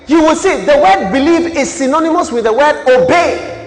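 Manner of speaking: loud, high preaching cry; full-force preaching. Acted. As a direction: preach it out to the hall. Preaching to congregation.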